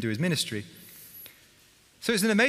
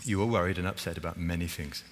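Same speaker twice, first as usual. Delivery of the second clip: gentle Jesus; 6 semitones lower, 4 dB quieter than usual. Retold or imitated. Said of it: "Jesus sums up her situation".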